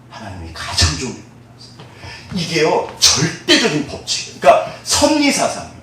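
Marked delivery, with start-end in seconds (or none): none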